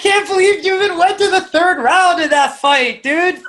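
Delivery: high-pitched amazed outburst; real disbelief. Real, not acted. Host hears the weight-cut ordeal, astonished disbelief. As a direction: blurt it out, amazed and incredulous.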